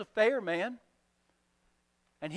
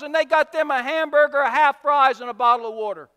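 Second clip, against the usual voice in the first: loud, higher gloating voice; greedy servant; 7 semitones higher, 10 dB louder than usual. Acted. Forgiven servant spotting his small debtor, loud gloating. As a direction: pounce on the discovery with glee.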